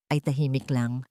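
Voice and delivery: ordinary delivery, nothing marked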